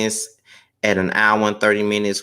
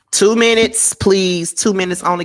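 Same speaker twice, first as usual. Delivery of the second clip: TWO MINUTES pitched high and stressed, a firm plea; emphatic plea; 9 semitones higher, 5 dB louder than usual. Real, not acted. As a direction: lean hard on "two minutes, please", voice lifted. Pleading with callers to keep time.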